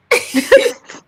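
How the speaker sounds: a burst of high-pitched laughter. Real, not acted. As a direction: let out a sudden squeal of a laugh. Laughs after removing a chatter.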